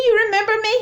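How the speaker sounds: very high, incredulous question; retold amazement. Cashier recognised by a customer, retold amazement. Disbelief at being remembered.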